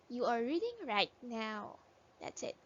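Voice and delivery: usual speaking voice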